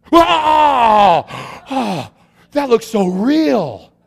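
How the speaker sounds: loud, high cry of astonishment, then drawn out; slow, wide-eyed mock amazement; amazed gasp. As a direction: imitate an awestruck boy. Owner's son startled by mannequin.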